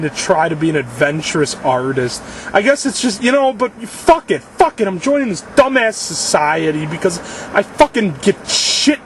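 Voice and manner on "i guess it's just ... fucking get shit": high, worked-up swearing rant; real overwrought, exasperated outburst; too upset to talk. Real, not acted. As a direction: spit out the frustration till words fail. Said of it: "I can't even talk right now".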